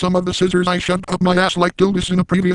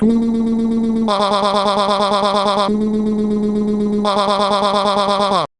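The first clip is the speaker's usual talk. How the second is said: hammed-up moaning; 2 semitones higher, much slower than usual. Narrator hams up moaning for a gross joke.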